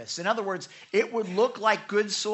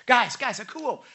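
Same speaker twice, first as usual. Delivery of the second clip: raised, higher attention call; 5 semitones higher, 6 dB louder than usual. Retold or imitated. Teacher in a boat calling the crowd, urgent attention call. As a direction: call out to gather a distracted crowd. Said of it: "He goes out in that boat. He's like".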